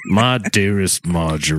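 hammed-up solemn wartime letter, pitched well down; Civil War letter-writer. Reading a soldier's letter home to Marjorie, mock-solemn.

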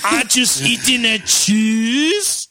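put-on French accent. Happy shark from France.

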